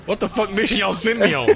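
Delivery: high, loud baffled complaint; voicing an outraged soldier. Soldier complains to his bosses about the mission, outraged. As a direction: yell it at the people who sent him.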